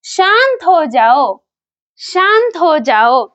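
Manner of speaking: slow, clear teaching delivery; slow demo. Shown as a demonstration. Teacher modelling the phrase for viewers, slow and clear. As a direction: say each word slowly for learners. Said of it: "तो हमारा पहला sentence है".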